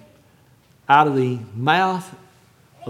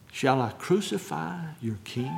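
grave, hushed question; 3 semitones lower, 10 dB quieter than usual. Retold or imitated. Preacher repeats Pilate's question without the mockery, quietly. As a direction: let the question sink in quietly. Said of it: "not in the same way that I said it".

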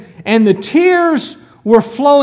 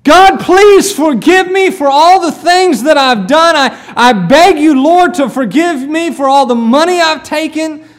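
loud, higher-pitched cry for forgiveness, easing at the end; desperate, anguished prayer of repentance; anguished plea; 3 semitones higher, 5 dB louder than usual. Acted. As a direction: beg God for mercy at full voice. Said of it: "with a fit of anguish, he just says".

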